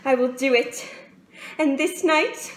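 firm vow rising to a high, loud climax; building resolve. Acted. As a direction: build from determination to a triumphant cry.

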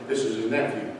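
low, soft deadpan bluff; hushed mock-ominous reveal; deadpan threat. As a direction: drop your voice for the punchline. Punchline bluff to the kid.

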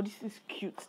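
soft whisper. Real, not acted. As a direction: whisper it like a secret. Whispered aside.

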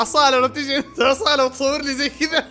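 laughing while talking; can't stop laughing through words. Real, not acted. Host laughing at a caller.

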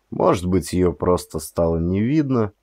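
usual speaking voice

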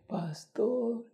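high, soft tearful cry of a sick man; a dying man breaking into tears at the sight of his pastor. Retold or imitated. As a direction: call the pastor's name through sudden tears. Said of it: "empieza a llorar".

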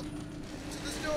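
usual speaking voice

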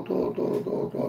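whisper. Real, not acted. Todo repeated, now whispered.

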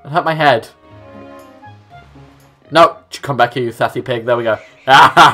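ordinary talk

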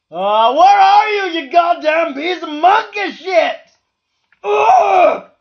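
high, loud furious shout ending in a cry; real rage at the missing tool; furious yelling. Real, not acted. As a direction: scream at it at full force.

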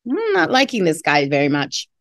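plain reading voice

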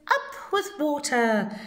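high hooting call from a herd of duckbills; lightly voiced hooting duckbill; hooting dinosaurs. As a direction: hoot it up high like a happy dinosaur. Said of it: "And they hooted".

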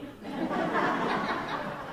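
a quick breathy laugh at his own joke; short laugh. Real, not acted. Pastor laughing at his joke during announcements, brief laugh. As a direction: let a little chuckle slip out.

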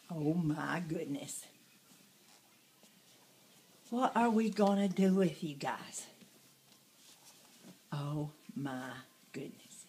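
hushed and low, almost a whisper; whispered fond exasperation. Real, not acted. Grandmother at the toddlers, hushed and fondly exasperated.

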